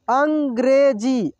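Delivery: अंग्रेजी drawn out slowly, syllable by syllable; slow sounding out. Shown as a demonstration. Teacher slowly reads अंग्रेजी for students to repeat. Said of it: "मैं अब बहुत आराम से पढ़ा रहा हूँ".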